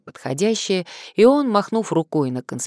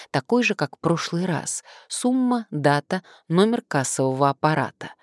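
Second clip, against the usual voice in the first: ordinary delivery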